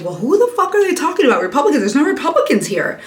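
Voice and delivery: half laughing, throw up your hands in disbelief; incredulous outburst. Retold or imitated. Mocking the dinner guests' blanket statements, laughing disbelief.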